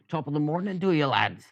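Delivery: high, lilting fake Irish greeting; mock Irish accent. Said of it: "like, an Irish accent".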